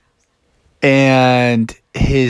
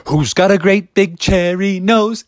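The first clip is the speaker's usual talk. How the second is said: put-on another kid; 6 semitones higher than usual. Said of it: "another kid was like".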